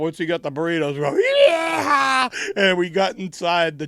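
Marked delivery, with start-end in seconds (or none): 1.5-2.3 high, loud whoop of glee. Retold or imitated. Friends whooping leaving the taco place, high and loud. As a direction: cheer like you got away with it. Celebrating after getting burritos.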